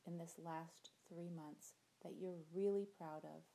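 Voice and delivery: gentle visualization voice, soft endings; guided meditation. Acted. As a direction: guide them through the imagery calmly.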